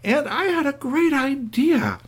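high, slow, gushing idea voice; bubbly enthusiasm. Acted. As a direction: sell the idea brightly and high. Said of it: "She said".

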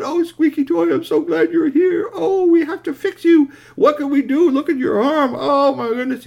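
put-on high, loud child's wail; loud, squealing joy and worry; emotional gushing. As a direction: gush and fret at high pitch. Child fretting over hugged toy.

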